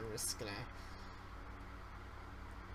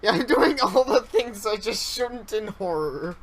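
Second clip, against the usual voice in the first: excited self-mockery; 7 semitones higher, 11 dB louder than usual. Real, not acted. Laughing at own horror blunders.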